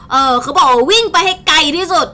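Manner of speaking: normal talking voice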